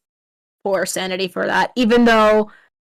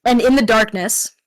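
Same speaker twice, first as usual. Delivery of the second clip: usual speaking voice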